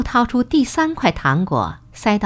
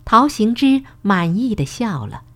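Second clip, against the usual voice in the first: usual speaking voice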